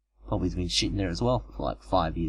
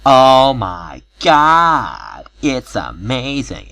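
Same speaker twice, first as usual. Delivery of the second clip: drawn-out, much louder high gasp of wonder; girl gasping at the amazing thing he shows her; amazed gasp; 5 semitones higher, 10 dB louder, much slower than usual. Retold or imitated. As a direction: stretch it out in loud awe.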